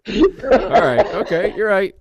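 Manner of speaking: high loud concession. Real, not acted. Mock solemn acceptance.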